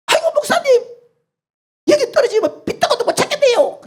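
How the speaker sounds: put-on panicked young man at the cliff; terrified youth.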